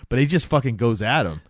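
normal talking voice

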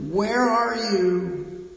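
high, slow, drawn-out wailing call; loon wail. Retold or imitated. Preacher voicing the loon's lonely call across water, high slow wail. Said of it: "The loon is just saying".

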